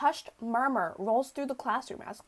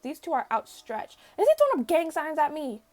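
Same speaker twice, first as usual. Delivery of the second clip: usual speaking voice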